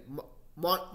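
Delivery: ordinary delivery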